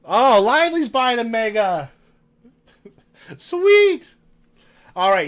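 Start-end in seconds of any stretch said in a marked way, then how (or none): 0.1-1.9 loud, high, delighted exclamation; excited announcement. Real, not acted. Streamer cheering a viewer's mega box purchase, excited. 3.4-4.1 very high, loud squeal of delight; excited cheer. Real, not acted. Streamer thrilled by a mega box purchase, excited.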